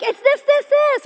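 high, loud, insistent repetition; urgent, impatient daughter. Retold or imitated. Daughter passing the code through glass, frantic. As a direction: fire off the clue, high and urgent.

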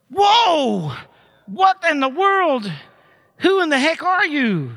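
a slow, stretched "What in the world?"; amazed outcry. Retold or imitated. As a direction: react as if thunderstruck by Jesus. Preacher acts out amazement.

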